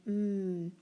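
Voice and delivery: "mm" as a held hum; isolated consonant sound. Shown as a demonstration. Teacher segmenting the word them, held "mm". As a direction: hold "mm" with closed lips, no vowel. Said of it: "What do we hear in them?".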